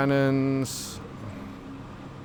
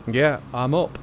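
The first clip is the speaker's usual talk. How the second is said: normal talking voice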